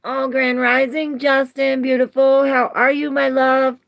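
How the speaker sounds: loud, high, sustained call; effusive greeting. Real, not acted. Streamer greets Justin as my love, effusive greeting. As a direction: hail them loudly on a high pitch.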